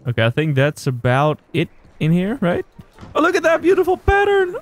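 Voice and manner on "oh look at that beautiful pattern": high-pitched, gushing admiration. Real, not acted. Player admiring a pattern in the game, high gushing. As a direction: coo over it way up high.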